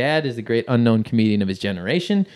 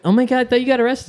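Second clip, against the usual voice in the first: high, fast, louder mock astonishment; put-on breathless-paced amazement, pitched way up; amazed students; 11 semitones higher, 5 dB louder, much faster than usual. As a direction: imitate excited students in a rushed high voice. Students amazed at his return.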